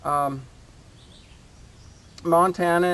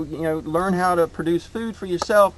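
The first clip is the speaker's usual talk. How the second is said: ordinary delivery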